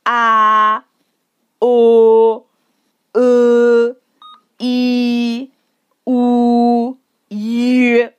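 long, held "a, o, e, i, u, y" on a level pitch; drawn-out model vowels. Shown as a demonstration. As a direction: sustain each of "a, o, e, i, u, y" evenly, separately. Teacher models vowels for repetition.

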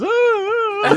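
muffled gagged moaning. Retold or imitated. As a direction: moan through a gag. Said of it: "e eles, tipo, amordaçados".

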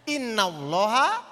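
pelan dan jauh lebih rendah, seperti mengaji; lantunan ayat. Acted. Quoting Quran verse in Arabic. (ustaz melantunkan ayat untuk jamaah, khidmat dan pelan)

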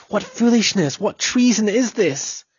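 a father bursting out in rage at his son. Acted. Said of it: "to one of rage".

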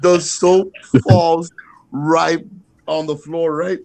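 ordinary talk, nothing marked

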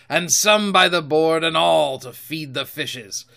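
punchy, emphatic pirate threat; forceful old sea dog laying down the law; Silver, menacing captain. Acted. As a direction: put a mutineer in his place.

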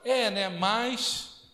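slow, skeptical "É, né, mas"; doubtful drawl. Retold or imitated. Doubter dismissing a testimony, slow skeptical drawl. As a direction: drag it out unconvinced. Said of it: "a pessoa fala".

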